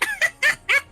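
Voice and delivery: high cackling witch laugh; witch cackle. Acted. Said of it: "That was a good witch laugh".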